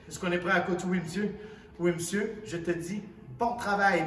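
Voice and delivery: normal talking voice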